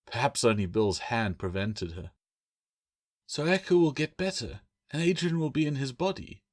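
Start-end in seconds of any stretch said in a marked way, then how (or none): 3.3-6.4 put-on higher, hopeful young woman's question; ask, daring to hope; hopeful Claire. A narrator's reading. Claire daring to hope her brother recovers, higher hopeful voice. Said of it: "daring to hope".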